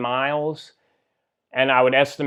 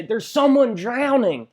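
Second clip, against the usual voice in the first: retold yell; 9 semitones higher than usual. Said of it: "I scream back to my wife".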